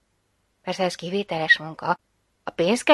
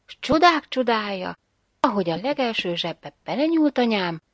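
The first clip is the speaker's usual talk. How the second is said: ordinary delivery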